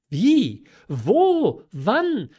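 high, loud, startled one-word questions; agitated, pitch leaping on every word; bewildered questions. A narrator's reading. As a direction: fire out each question in confusion. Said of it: "fragte die Marquise verwirrt".

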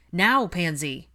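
loud, firm command; ordering. A narrator's reading. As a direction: order her to move at once. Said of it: "Draco ordered".

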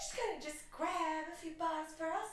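high Southern gossip voice; hammed-up Southern accent; Southern belle. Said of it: "those Southern belles".

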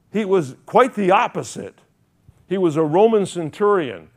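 loud, high fiery sermon voice; preaching at full force. Acted. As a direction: thunder it from the pulpit.

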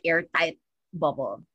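usual speaking voice

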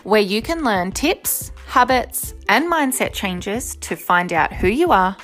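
bright, upbeat show opener; podcast intro. Acted. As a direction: welcome listeners like a host.

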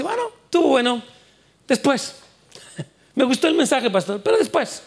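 high, breezy voice brushing it off till later; mock a listener who keeps postponing; lazy churchgoer.

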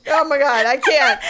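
usual speaking voice

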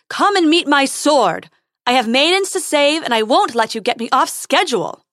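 loud, bold heroic challenge; calling out. A narrator's reading. Prince demands the dragon fight him, shouting boldly. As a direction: shout it like an impatient hero.

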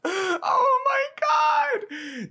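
cracking up, high squealing laugh; helpless laughter. Real, not acted. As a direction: lose it completely laughing. Cracks up at own joke.

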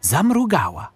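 ordinary delivery

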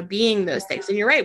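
ordinary talk, nothing marked

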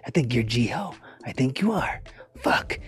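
soft unvoiced accusing whisper; jealous whisper. Host whispering jealous suspicion at his podcast friend. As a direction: hiss the suspicion under your breath.

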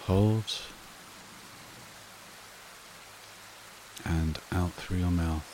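soothing breathing-exercise delivery, spaced out and unrushed; guided meditation. Acted. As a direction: guide them gently into calm, phrase by unhurried phrase.

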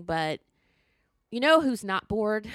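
usual speaking voice

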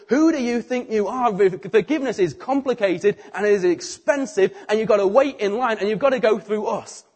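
a hint of pompous outrage; indignant scoff. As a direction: put the upstart firmly in his place. Indignant teachers of the law.

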